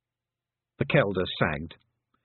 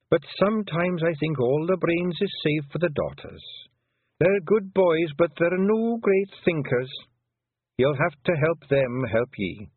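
put-on higher Scottish old-woman voice, slow and proud; an old clan mother talking fondly of her lads; old Pictsie mother; 8 semitones higher than usual. Old Kelder tells Tiffany about her many sons, higher Scots burr.

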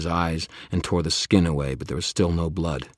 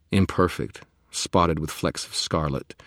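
usual speaking voice

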